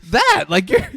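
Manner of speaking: high, loud mock-exasperated outburst; upset wife. Wife to husband about the spaceship, exasperated mock yell. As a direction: imitate an incredulous wife blowing up. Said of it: "she's really upset".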